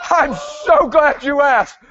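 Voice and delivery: slow, relishing delight; gleeful eagerness. Retold or imitated. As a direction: pounce on the question with drawn-out glee. Gleeful answer to a seatmate.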